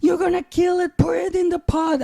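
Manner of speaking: high-pitched freaking-out yelp; panicked wife. Retold or imitated. Wife shouts at husband holding the money tree, mock panic. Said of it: "my wife starts to freak out".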